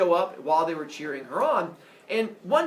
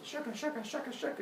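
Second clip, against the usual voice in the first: higher, soft shucka-shucka sound effect; loom shuttle noise; 7 semitones higher, 10 dB quieter than usual. Retold or imitated. Teacher mimics the loom's back and forth, imitated noise.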